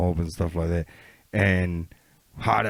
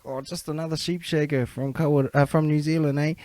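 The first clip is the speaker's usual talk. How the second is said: higher-pitched taunting Aussie impression; Aussie gamer; 6 semitones higher, 5 dB quieter than usual. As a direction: put on an Australian stranger's jibe. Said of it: "who was from Aussie, and he's like".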